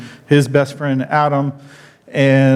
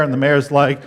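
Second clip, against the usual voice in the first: ordinary delivery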